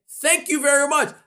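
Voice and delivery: bright, high mock-cheer of a friend; friend's hammed-up pep talk; cheery supportive friend. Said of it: "They're gonna say".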